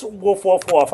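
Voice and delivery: mock woof woof barks like a dog; dog barking. Streamer playing a dog in game, mock barking. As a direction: bark it out playfully as a dog. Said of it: "I'm a dog now".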